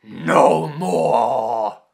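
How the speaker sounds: very loud commanding roar; thundering. Acted. Pirate chief halting battle, loud thundering. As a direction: thunder it to stop the fight. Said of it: "Billy Barbosa thundered".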